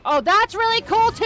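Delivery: loud, high overexcited yell; mock enthusiasm. Real, not acted. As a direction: ham up fake excitement. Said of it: "repeated far louder".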